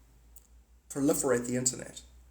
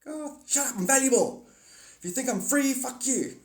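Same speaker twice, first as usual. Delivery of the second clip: mock diva outburst; 10 semitones higher than usual. Vlogger mocking freeloaders in a high indignant squeal.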